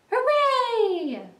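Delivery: high, loud cheer; joyful cry. A narrator's reading. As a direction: cheer out in triumph at a find. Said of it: "the rat cried".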